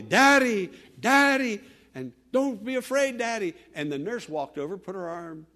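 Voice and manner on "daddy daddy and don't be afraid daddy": high, loud calling out to a dying father; calling Daddy loudly and desperately; retold loud crying out. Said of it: "She began to loudly".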